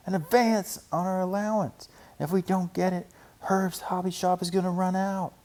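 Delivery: high, eager little cub; sister cub voice. A narrator's reading. Sister cub pleading with Papa for money, high and eager. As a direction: play an eager young cub.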